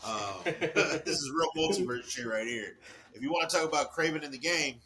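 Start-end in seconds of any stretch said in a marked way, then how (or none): 0.1-2.8 laughing. Real, not acted. Laughing joke about multiverse.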